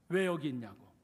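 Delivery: ordinary talk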